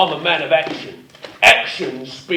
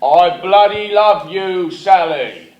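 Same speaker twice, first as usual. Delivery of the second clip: louder, slow, overdone love line; hammy love declaration; 5 dB louder, slower than usual. Acted. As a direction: bellow the love line like a clumsy hero. Actor delivering changed line on take.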